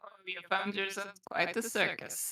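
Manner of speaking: usual speaking voice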